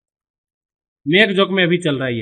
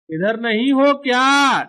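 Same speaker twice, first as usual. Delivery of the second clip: slow, raised calling voice; animated character calling; 5 semitones higher, 3 dB louder, slower than usual. Acted. Character searching for someone, slow drawn-out calling. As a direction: stretch the question out, pitched up. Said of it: "अब ये बोलेगा".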